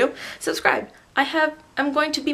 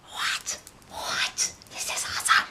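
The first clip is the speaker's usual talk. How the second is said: whispered awe; 9 dB quieter than usual. Retold or imitated. Fading to whisper.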